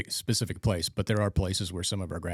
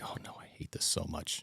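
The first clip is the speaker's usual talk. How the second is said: voiceless, much softer groan of dread; whispered dread; 2 semitones lower, 10 dB quieter than usual. Retold or imitated. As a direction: breathe it out like a private complaint. Dreading role play, inner thought.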